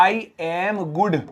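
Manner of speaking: slow and soft; slow wrong example. Shown as a demonstration. Teacher quotes the common phrase slowly and softly. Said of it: "यही तो बोलेंगे".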